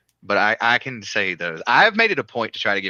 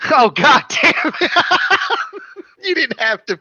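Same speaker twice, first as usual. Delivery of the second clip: real dismay at being caught out; exasperated outburst; 7 dB louder than usual. Real, not acted. Caught out on screen.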